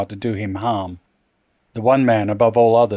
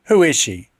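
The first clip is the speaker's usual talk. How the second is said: angry; 4 semitones higher than usual. Acted. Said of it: "demanded savagely".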